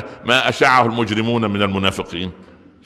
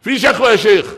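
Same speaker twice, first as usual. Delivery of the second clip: higher, louder mock call; hammed-up plea; woman's complaint; 10 semitones higher, 6 dB louder than usual. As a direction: imitate a woman calling out to the sheikh. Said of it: "فتحت الباب".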